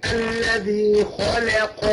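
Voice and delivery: very slow chant, خلق with a thin ل; light lam. Shown as a demonstration. Teacher repeating the correct خلق, slow with light ل. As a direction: draw out خلق, keeping ل light. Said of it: "ما نفخمش اللام".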